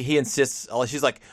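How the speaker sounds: ordinary talk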